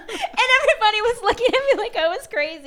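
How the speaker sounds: high, loud laughter through the words; laughing hard. Real, not acted. Telling the hosts about crying on the street, laughing. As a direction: laugh it out, overwhelmed by the memory.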